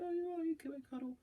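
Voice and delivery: very high, soft, quick cooing voice; mock-sweet wife inviting a cuddle. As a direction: coo it like a doting wife. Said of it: "she didn't say it like that".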